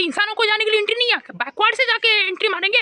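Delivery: high, fast, disbelieving rant; incredulous rant. Acted. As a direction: rattle it off in outraged disbelief. Mocking mall entrance design.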